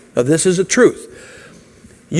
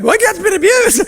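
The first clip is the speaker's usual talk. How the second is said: much higher mock voice of a pet owner. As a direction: squeak it out like a silly pet owner. Mocking a man in a pet ad.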